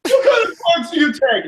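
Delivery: high, hammed-up hysterical questioning; high-pitched mock interrogation; mock frantic yelling. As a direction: imitate a frantic mother demanding answers. Acting out the mother's frantic questioning.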